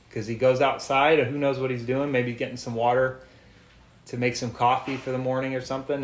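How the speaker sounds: normal talking voice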